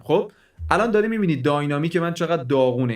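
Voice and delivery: ordinary talk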